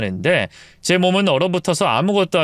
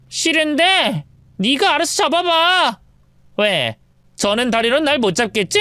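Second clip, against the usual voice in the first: teasing mistress; 10 semitones higher than usual. Acted. Mistress mocks the wife who can't catch her, teasing. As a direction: taunt her playfully.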